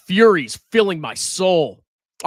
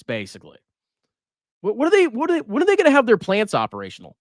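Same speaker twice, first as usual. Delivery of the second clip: normal talking voice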